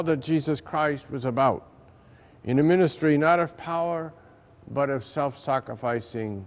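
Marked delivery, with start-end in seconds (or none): none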